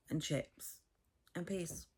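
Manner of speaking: whispered "and peas". Real, not acted. Listing dinner, whispering "and peas" as an aside.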